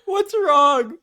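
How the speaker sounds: clueless mom, cracking up while quoting; giggly oblivious mother; laughing mom impression. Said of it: "And his mom is like".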